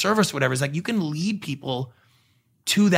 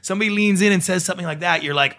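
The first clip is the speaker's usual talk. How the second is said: ordinary talk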